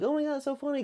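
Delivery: high, light little-girl imitation; mock giggly girl. Little girl watching the mouse in her dollhouse, high and giggly. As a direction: pitch it way up and soft, imitating her. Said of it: "she's, like, giggling".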